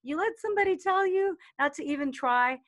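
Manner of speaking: high, shocked disbelief; can't believe they let someone say that; incredulous. Retold or imitated. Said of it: "And I'm like".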